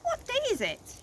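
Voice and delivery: very high, fussy questioning voice; Mad Hatter. A narrator's reading. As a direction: squeak the question like a flustered eccentric. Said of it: "The Hatter".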